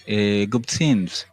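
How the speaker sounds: ordinary talk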